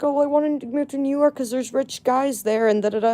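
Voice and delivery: high, airy mock of a spoiled girl; mocking girl's voice. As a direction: parrot her like a ditzy rich girl. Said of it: "She's like".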